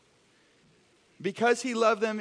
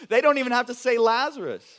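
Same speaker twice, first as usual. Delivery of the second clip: louder and pressed hard; louder emphatic repeat; 5 semitones higher, 9 dB louder, faster than usual. Shown as a demonstration. Pastor repeats the point to the congregation, emphatic. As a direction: drive the point home to the room.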